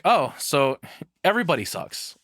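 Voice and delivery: ordinary delivery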